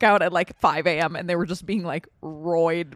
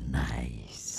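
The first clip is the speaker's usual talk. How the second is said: low unvoiced whisper; 12 dB quieter than usual. Real, not acted. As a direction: breathe it out low and slow. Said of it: "That was me saying nice".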